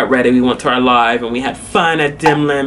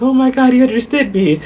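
high-pitched imitation of embarrassed surprise; flustered; 10 semitones higher than usual. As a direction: mimic her flustered realization, pitched up. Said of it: "like, flustered".

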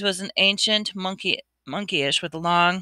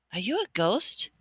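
uncertain; 3 semitones higher, 6 dB quieter than usual. Acted. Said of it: "Harry said uncertainly".

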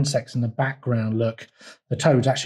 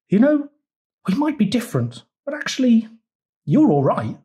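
lightly voiced toad; 9 semitones higher than usual. A narrator's reading. Toad making up with Frog.

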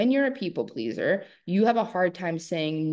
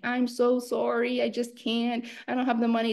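apologetic people pleaser; 5 semitones higher than usual. Retold or imitated. A woman making excuses to a seller, high and meek. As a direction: plead sorry in a small high voice. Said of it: "but you're saying".

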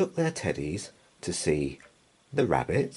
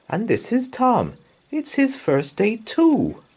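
higher, bright introducing voice; friendly teacher; 6 dB louder than usual. A narrator's reading. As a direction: present Tom cheerfully. Introducing Tom to child.

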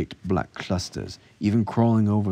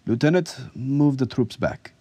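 high, called-out military order; command; 8 semitones higher, 4 dB louder than usual. A narrator's reading. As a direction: order the lieutenant sharply. Officer orders lieutenant to move troops.

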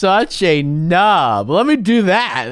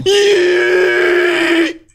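high, hyped shout; excited yell; 12 semitones higher than usual. Real, not acted. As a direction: yell it as you leap. Jumping in game, hype cry.

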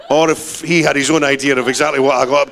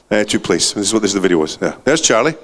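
ordinary talk

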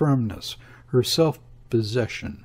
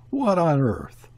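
bewildered; 6 semitones higher than usual. A narrator's reading. Nelson bewildered at Heemskerk.

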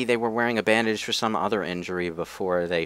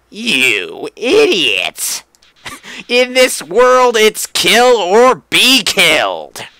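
high, loud menacing southern drawl; slow Southern-accented taunt, higher and louder; southern Flowey; 10 semitones higher, 9 dB louder, slower than usual. Retold or imitated. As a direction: sneer it like a Southern villain flower. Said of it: "making Flowey sound southern".

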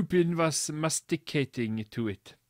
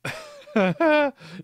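high helpless laugh; real burst of laughter; 5 dB louder than usual. Real, not acted. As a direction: crack up at the story. Reader cracks up.

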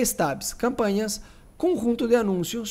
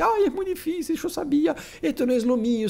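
much higher, whining mock despair; whiny despairing pastor; 9 semitones higher than usual. Teacher mocks a crybaby pastor quitting, high whine. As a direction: squeal it like a pastor giving up. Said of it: "se desespera y dice".

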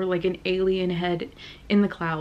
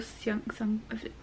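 whisper; 12 dB quieter than usual. Real, not acted. Unsure of a name, whispering.

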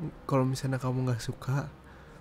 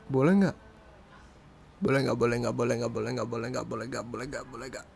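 teasing nag fading. Pretend. Teasing his partner until she gets upset, playful nagging fading out. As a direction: keep nagging playfully, then let it die away.

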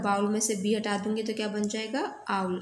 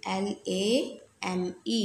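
slow spelling; much slower than usual. Shown as a demonstration. Teacher spelling lame for students, slow and clear. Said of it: "spells the word".

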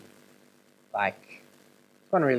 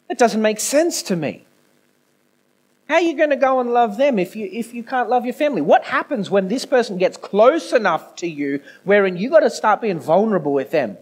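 loud, high-pitched challenge to the congregation; forceful preaching; 8 semitones higher, 7 dB louder than usual. Real, not acted. Preacher challenging those who struggle to love family, forceful. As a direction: preach it hard, driving each point home.

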